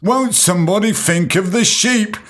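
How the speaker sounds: high, wailing mock-concerned voice; mock outraged plea. Podcaster mocking sheep defenders with a high, wailing mock plea. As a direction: wail it like a pearl-clutching do-gooder.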